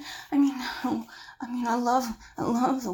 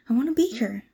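usual speaking voice

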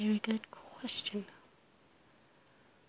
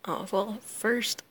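ordinary talk, nothing marked

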